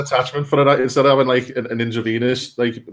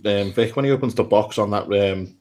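ordinary talk

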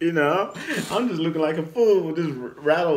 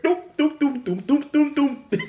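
high, bouncing doop noises; imitated hail hits; 10 semitones higher than usual. Hail tagging his bald head, high comic sound imitation. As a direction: mimic hail pinging off a head in high notes. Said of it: "You can just hear it".

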